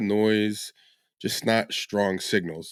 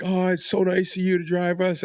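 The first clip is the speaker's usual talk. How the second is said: high, gushy imitation of tipsy girls; gushing drunk girls; 10 semitones higher than usual. Tipsy girls in the back seat thanking the driver, high and gushing. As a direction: lift it way up like a tipsy, gushing passenger.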